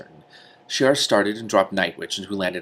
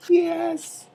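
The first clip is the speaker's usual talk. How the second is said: high delighted yelp, then loud; thrilled reader celebrating; gleeful cheer; 8 dB louder, much slower than usual. Real, not acted. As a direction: cheer a favourite's return. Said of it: "I yelled at him".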